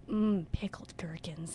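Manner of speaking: slow, low imitation of kids relishing each word; savoring delicious food. Theater teacher imitating kids relishing gherkins on stage, savoring. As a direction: savor it slowly, almost tasting the words. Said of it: "it was the most delicious sounding thing".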